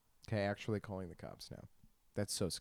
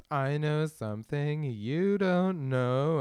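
put-on slow, louder, teasing ghost girl; spooky ghost voice; 5 semitones higher, 7 dB louder, much slower than usual. Ghost in his fantasy teasing him, slow creepy taunt. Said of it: "She just goes".

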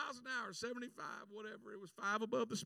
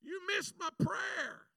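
a whining why-me prayer; pleading complaint; 7 semitones higher, 3 dB louder than usual. Retold or imitated. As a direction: reproach heaven from the roadside. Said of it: "I'm sitting here going".

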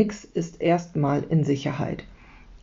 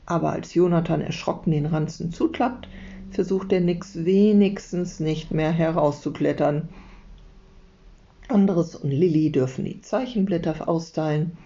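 ordinary delivery, nothing marked

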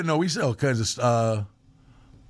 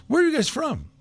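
higher-pitched voice for the lady; retold lady's question; 11 semitones higher than usual. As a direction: lightly imitate a curious woman asking.

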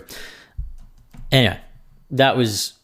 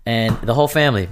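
ordinary talk, nothing marked

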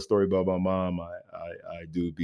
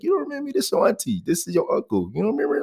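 high sing-song mock relatives; high teasing family voice; imitating family; 13 semitones higher, much faster than usual. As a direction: squeak it up like pushy aunties.

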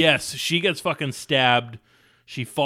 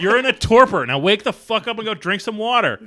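mock yell; 7 semitones higher, 5 dB louder than usual. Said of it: "continuing the mock yell".

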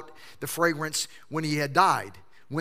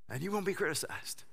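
dropped soft; 10 dB quieter than usual. Acted. Quote punchline dropped soft.

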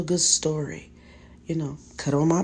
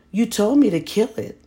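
put-on protesting child; 7 semitones higher, 6 dB louder than usual. A narrator's reading.

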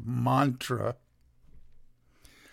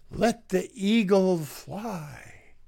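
lightly hammed-up slow slogan, pitched up; mock patriot proclamation; 4 semitones higher than usual. Lecturer mimicking so-called patriots' slogan, slow mock-heroic. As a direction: draw out the slogan in mock reverence.

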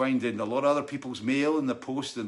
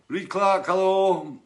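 loud greeting; 6 semitones higher, 7 dB louder, much slower than usual. Real, not acted.

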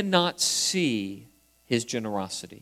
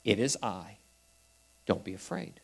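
gentle reassurance; 6 semitones lower, 9 dB quieter than usual. Acted. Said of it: "That's another way of saying".

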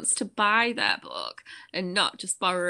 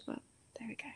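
a soft, breathy throwaway whisper; whispered aside; 2 semitones higher, 16 dB quieter, much faster than usual. Real, not acted. Cohost muttering a cheeky aside about where she was conceived, whispered. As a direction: drop to a whisper as if letting slip a secret.

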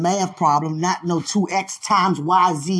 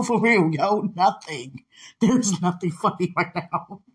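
usual speaking voice

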